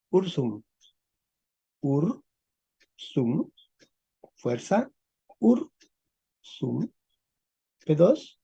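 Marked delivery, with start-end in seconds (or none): 1.8-3.5 "Ursum" softly split into two separate syllables. Shown as a demonstration. Teacher breaks word into syllables. 5.4-6.9 "Ursum" broken in two with a long gap; syllable by syllable. Shown as a demonstration. Teacher breaks word into syllables.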